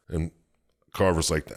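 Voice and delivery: usual speaking voice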